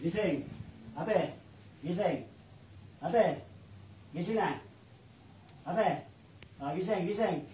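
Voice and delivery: low, soft repeated calls; coaxing awake. Real, not acted. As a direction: gently rouse someone after an ordeal. Healer wakes the woman afterwards.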